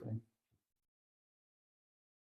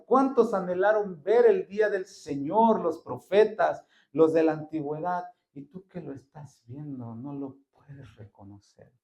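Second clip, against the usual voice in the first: ordinary delivery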